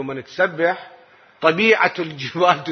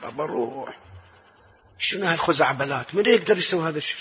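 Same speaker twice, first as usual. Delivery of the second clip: dismissive elders; 6 dB quieter, faster than usual. Retold or imitated. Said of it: "كانوا يقولون لنا".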